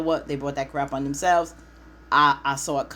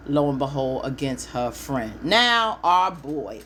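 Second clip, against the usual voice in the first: normal talking voice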